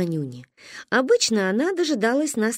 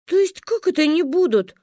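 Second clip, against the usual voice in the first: возмущённо; 8 semitones higher than usual. Acted. Каринка возмущена.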